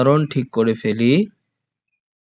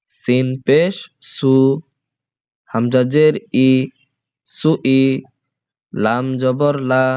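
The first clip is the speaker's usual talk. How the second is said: teacher's chanted letter-by-letter recitation; spelling drill; slower than usual. Shown as a demonstration. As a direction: spell out each syllable for the class to repeat.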